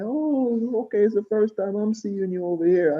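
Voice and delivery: high-pitched mimicked woman's voice; imitated store lady. Store lady greeting a new face, high imitated voice. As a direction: play the lady, pitched well up. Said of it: "she's like".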